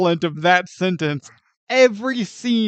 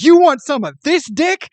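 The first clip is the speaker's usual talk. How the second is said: high, loud cocky challenge; mock tough guy; 9 semitones higher, 8 dB louder than usual. Frodo taunting the Nazgul, cocky and loud. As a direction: taunt like a brawler.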